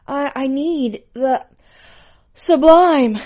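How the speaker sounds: high, halting plea bursting loud; stammer, then cry out the last word; impassioned high-pitched begging; ecstatic pet. A narrator's reading. Pet's cries during sex scene.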